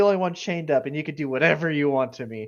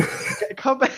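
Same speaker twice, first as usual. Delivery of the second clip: laughing plea; 10 semitones higher, 3 dB louder, slower than usual. Acted. Calling after players, laughing.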